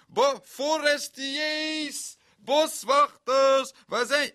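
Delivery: long, high, loud calls; calling out loud. Retold or imitated. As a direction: shout for the lost group in the street. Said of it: "riep ik".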